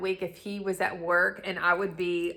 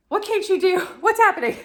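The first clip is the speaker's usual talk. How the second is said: much higher, louder startled cry; alarmed mom; 12 semitones higher, 10 dB louder, faster than usual. Retold or imitated. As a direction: burst in as the panicked mom.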